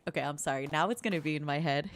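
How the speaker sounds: normal talking voice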